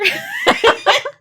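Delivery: loud high laugh; shrieking giggle; high squealing laugh. Real, not acted. Laughing at own pronunciation.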